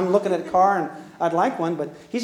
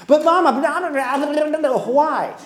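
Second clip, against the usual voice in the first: put-on whiny child protest; 7 semitones higher, 3 dB louder than usual. Child protesting to Mama after hearing no, whining. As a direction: plead like a kid who just lost.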